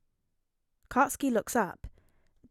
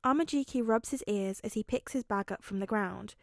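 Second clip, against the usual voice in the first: ordinary delivery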